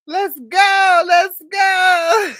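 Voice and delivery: high, loud celebrating shout; excited cheer. Real, not acted. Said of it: "celebrating own song".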